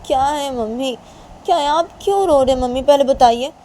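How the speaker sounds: high, loud, urgent questioning; worried girl pleading for an answer; concerned daughter. Acted. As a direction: ask your crying mother urgently, pitch climbing.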